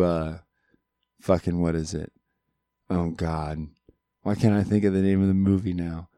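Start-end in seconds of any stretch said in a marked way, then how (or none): none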